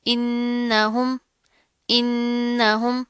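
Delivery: "इन्नहुम" with a long nasal hum on "न्न"; slow chanted "इन्नहुम", humming the n; ghunna. Shown as a demonstration. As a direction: hold the doubled n of "इन्नहुम", then move on. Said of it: "गुन्ना करेंगे".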